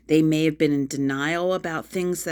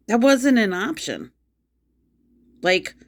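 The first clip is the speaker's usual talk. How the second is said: higher and louder, real indignation; angry; 6 semitones higher, 3 dB louder than usual. Real, not acted. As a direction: snap it out, fed up and indignant. Ranting about chores, angry.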